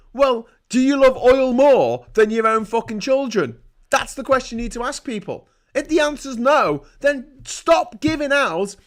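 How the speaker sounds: loud, high, urgent; furious rant. Real, not acted. Ranting at viewers about oil and children, loud. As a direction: pound each point home. Climate rant at full force.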